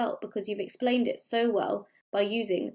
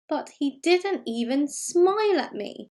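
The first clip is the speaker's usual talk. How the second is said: slow, higher-pitched exclamation of dismay; dramatic diary exclamation, pitched up; emphatic exclamation; 6 semitones higher, slower than usual. Acted. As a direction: read it slowly, as if in capital letters. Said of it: "put something in capital letters for emphasis".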